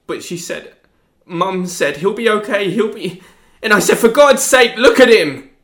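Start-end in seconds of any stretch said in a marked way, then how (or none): 1.3-5.5 higher and louder, building to a shout; rising panic as he relives it; frantic retelling. Acted. Teen tells lawyer about the argument, building to a shout.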